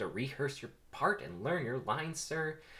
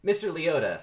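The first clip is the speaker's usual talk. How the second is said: booming address; 8 semitones higher, 10 dB louder than usual. Acted. Lawyer addressing witness grandly.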